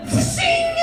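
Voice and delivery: high-pitched zing like a bullet; ricochet sound effect. Pretend. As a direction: make a bullet ricochet noise. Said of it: "I make my own sound effects".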